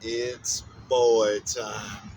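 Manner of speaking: put-on It's boy time, stretched out very slowly; slow, savoring drawl. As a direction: drag out boy like a lazy drawl.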